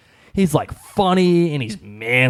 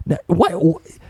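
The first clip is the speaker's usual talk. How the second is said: ordinary talk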